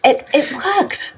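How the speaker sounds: high, slow, laughing delivery; giggling in amused disbelief. Real, not acted. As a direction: say it through real laughter. Amused that the trick worked.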